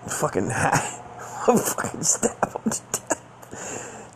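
high, breathy laugh breaking up the words; laughing retold quote. Retelling a friend's wild idea, laughing through it. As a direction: crack up while quoting the line.

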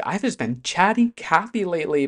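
usual speaking voice

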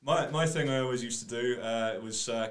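ordinary talk

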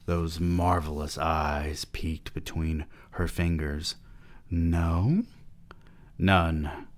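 hushed audiobook reading; story reading. Acted. Reads the novel aloud.

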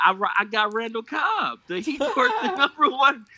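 loud, high-pitched defence; excited boasting protest. Real, not acted. Co-host defends Randall Cobb to host, loud and high-pitched. Mock-outraged boast about his player.

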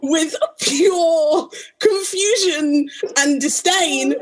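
ordinary talk

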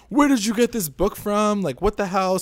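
high, loud alarmed demand; mock angry parent. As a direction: play the panicked parent catching the kid out. Parents angry about the book.

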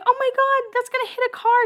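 high-pitched squeal of alarm, retold; mock alarm. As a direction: act out a startled crowd. Everyone panics as champagne pops.

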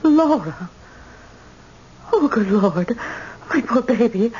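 high, stricken cry of pity; anguished. Acted. Mother shocked at her ruined daughter's state. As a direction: heartbroken at the sight.

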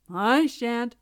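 loud, sturdy cock refusal; grumbling rooster. A narrator's reading. Cock refusing to fetch water, grumbling.

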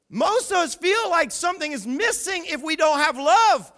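ordinary delivery

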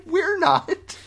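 high giggly protest; laughing. Real, not acted. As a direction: squeal it through laughter. Laughing playful argument.

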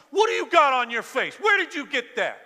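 loud, high-pitched outraged demand; scolding parent. Acted. Parent catches daughter wearing makeup, loud scolding. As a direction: confront the child like a shocked parent.

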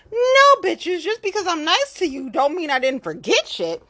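high, loud "No", then a hard telling-off; fed-up defiant rant; heated call-out. Real, not acted. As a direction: shout down the liars. Calling out liars.

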